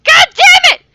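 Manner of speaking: loud, high frustrated yell; real angry outburst; furious shout. Real, not acted. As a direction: blow up at the mic mistake. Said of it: "my microphone was up above my".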